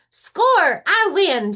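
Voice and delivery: high triumphant shout as Rabbit. Acted. Rabbit crowing over a trash-can hoop, loud and high. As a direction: yell out the win, way up high. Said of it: "shouts Rabbit".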